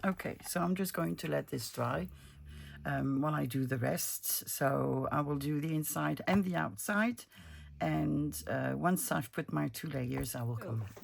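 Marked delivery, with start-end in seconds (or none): none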